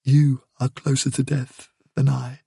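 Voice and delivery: plain reading voice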